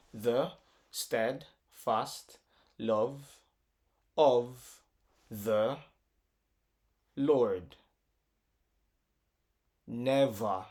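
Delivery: "the steadfast love of the Lord never", long pause after each beat; broken into syllables. Shown as a demonstration. Teacher counts syllables, "the steadfast love of the Lord never" spaced out one by one. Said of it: "break this in syllables".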